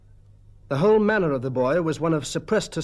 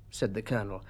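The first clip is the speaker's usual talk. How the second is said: ordinary delivery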